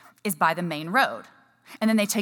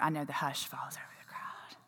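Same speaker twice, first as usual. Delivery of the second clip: whispered, much softer aside; hushed aside; 5 semitones lower, 14 dB quieter than usual. Pretend. Runner teasing her audience about their silence, hushed aside.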